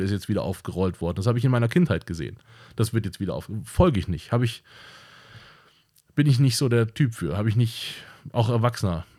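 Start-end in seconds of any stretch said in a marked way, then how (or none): none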